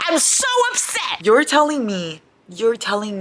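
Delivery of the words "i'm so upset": high wail. Real, not acted.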